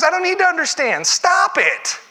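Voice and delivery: high, loud yell cutting her off; hammed-up shout with a laugh; comic yell. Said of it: "He goes".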